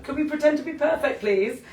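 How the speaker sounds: laughing plea. Real, not acted. To the dogs on camera, laughing plea. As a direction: laugh while begging the chaos to calm. Dogs crash the Instagram live.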